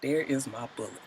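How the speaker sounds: soft, hushed, near-whispered relief. Retold or imitated. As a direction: breathe it out softly as if to yourself. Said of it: "She just sort of like".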